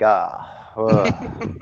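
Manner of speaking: low, soft laughing "ah, ugh" of disgust; disgusted groan. Retold or imitated. Stepping in warm pee, a low laughing disgusted groan. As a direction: groan like you just stepped in something warm.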